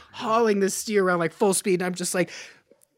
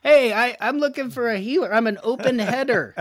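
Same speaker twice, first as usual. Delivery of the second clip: louder, higher hail to the crowd; calling out; 8 semitones higher, 4 dB louder than usual. Pretend. Roper calling to strangers for a healer, loud call. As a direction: shout out for any taker.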